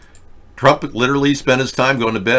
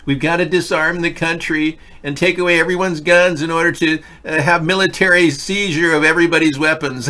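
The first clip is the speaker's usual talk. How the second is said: higher mock liberal voice quoting the party line; mocking Democrat; 8 semitones higher, 3 dB louder than usual. Host mocks what Democrats supposedly want, in a higher parody voice. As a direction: parody the gun grabbers with a raised mocking voice. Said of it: "at the bottom of every Democratic message".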